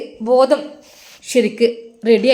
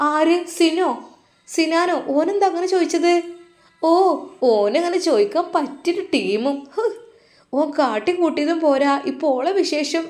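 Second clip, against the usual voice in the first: higher, scornful sister's voice; indignant sister; 6 semitones higher than usual. Acted. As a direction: sister pitched up in outrage at her brother. Angry sister-in-law on hospital call. (ഉയർന്ന സ്വരത്തിൽ പരിഹാസം)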